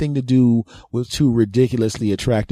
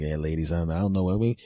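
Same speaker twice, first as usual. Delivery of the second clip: faking composure; 5 semitones lower, 8 dB quieter, faster than usual. Retold or imitated. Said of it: "not to sound like I'm out of breath".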